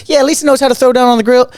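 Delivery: high, loud mock enthusiasm; hammed-up bragging on someone's behalf; fake hype. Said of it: "do that fake thing".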